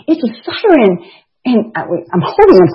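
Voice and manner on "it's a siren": put-on excited to toddler.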